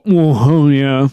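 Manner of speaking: talking through a mouthful; slow, chewing while you say it; mouth full. Pretend. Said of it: "with your mouth full and go".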